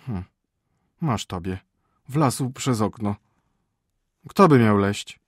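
offended denial, building loud; slow disbelief ending in a loud retort; indignant cook. Acted. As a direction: act affronted by the accusation. Cook denies the accusation.

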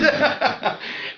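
high unvoiced giggle; breathy laugh. Real, not acted. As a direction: laugh under your breath. Laughing before doing the voice.

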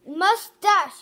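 loud cry. Acted. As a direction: blurt it out as he runs. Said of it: "he cried".

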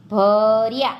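ભર્યા loud and drawn out; slow word demonstration. Shown as a demonstration. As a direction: stretch the first syllable of ભર્યા. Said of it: "જોડણી પર ધ્યાન આપજો".

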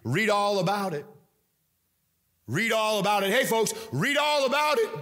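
high, carrying street cry; building paperboy's holler; newsboy hawking papers. Acted. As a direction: call out the headline to passers-by. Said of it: "what do they say?".

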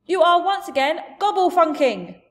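high, loud giant outburst; angry giant shout. Acted. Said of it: "the giant shouted".

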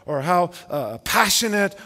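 ordinary delivery

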